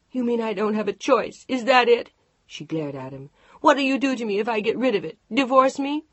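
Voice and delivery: furious wife yelling; angry shouting. Acted. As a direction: throw it in his face.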